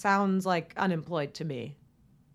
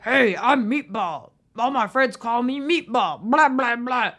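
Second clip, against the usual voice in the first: loud, higher cartoonish put-on voice; hammed-up mock introduction, big and loud; Meatball; 3 semitones higher, 6 dB louder than usual. Contestant introducing himself as Meatball, mocked in a goofy voice. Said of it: "I know that's not how his voice sounded".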